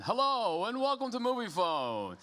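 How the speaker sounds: high, booming phone-line greeting; Moviefone announcer voice. Retold or imitated. CEO greeting the panel audience in the old Moviefone announcer voice. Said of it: "That was very good".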